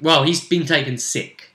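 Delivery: ordinary talk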